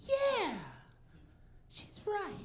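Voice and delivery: squeaky high, meek yes-voice; a high, meek, whipped boyfriend voice; meek agreement. Pretend. Agreeing meekly with his girlfriend, squeaky high.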